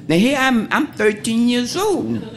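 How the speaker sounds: laughing, high and slow; amazed. Real, not acted. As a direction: spell out her age in delighted disbelief. Marvelling at her own age.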